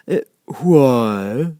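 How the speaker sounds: loud, bored creature voice; a lazy grunt of a question; caterpillar. A narrator's reading. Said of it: "said the caterpillar".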